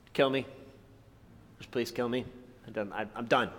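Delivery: low, soft defeated plea; upset Jonah. Acted. Jonah asking God to end it, upset and defeated. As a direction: give up quietly, voice dropped. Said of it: "so upset, he says".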